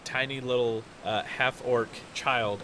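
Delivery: usual speaking voice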